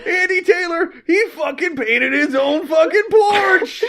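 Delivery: high, shouted mock bulletin; scandal announcement. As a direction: holler it as breaking gossip. Acted broadcast of the scandal.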